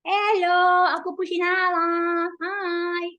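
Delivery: high cute pet voice; voicing the cat. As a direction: speak for the cat, sweet and high. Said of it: "Pakita niyo yung aking".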